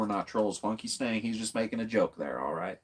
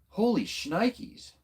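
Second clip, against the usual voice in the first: drawn-out exclamation; 10 semitones higher, much slower than usual. Real, not acted. Shocked by a big chat surge.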